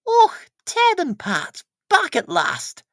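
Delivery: high, slow, bright-voiced postmistress greeting; Mrs Goggins voice.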